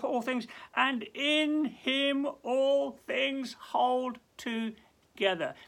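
sermon's emphatic peak; emphatic slow climax. Acted. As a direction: drive it home slowly. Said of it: "emphatic climax".

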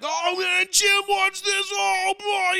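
high, excited mock announcer yelp; giddy booth voice, well up high; imitating excited Romo. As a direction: squeal it like a giddy commentator.

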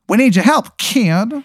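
high, slow wiseguy drawl; old-timey gangster introducing himself; 1920s gangster. Acted. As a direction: cactus gangster saying his name. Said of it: "He goes".

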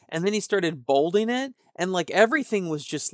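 normal talking voice

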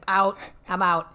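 loud, abrupt opt-out; emphatic refusal. Retold or imitated. Child refusing the Mother's Day tea, loud and emphatic. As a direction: announce it loudly and be done.

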